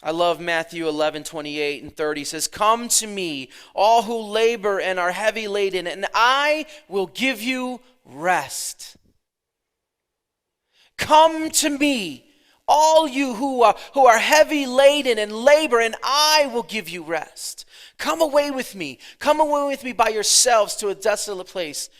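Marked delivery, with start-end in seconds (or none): 2.5-9.0 slow, raised proclamation; preacher calling out a Bible verse; preaching scripture. Acted. 11.0-21.9 impassioned preacher proclaiming an invitation; full-force preaching. Acted.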